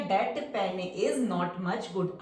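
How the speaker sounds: That pen is बहुत धीरे, हर शब्द अलग; शब्द-शब्द खींचकर पढ़ो; slow reading demo. Shown as a demonstration. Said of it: "एक meaningful sentence". (That pen is बहुत धीमे)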